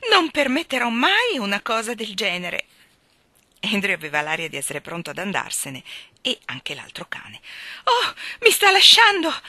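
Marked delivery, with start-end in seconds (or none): 0.1-2.7 high, loud wailed refusal; moaning. A narrator's reading. Miss Lark refusing the second dog, moaning. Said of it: "gemette Miss Lark". 7.8-9.3 very high, loud shriek. A narrator's reading. Miss Lark watching her dog abandon her, shrieking. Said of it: "strillò Miss Lark".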